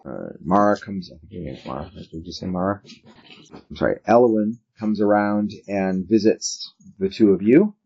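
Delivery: ordinary delivery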